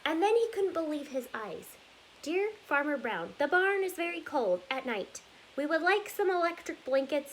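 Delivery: lively storybook read-aloud, rhythmic and bouncy; reading to children. Acted. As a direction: read it like story time for little kids. Reads picture book aloud.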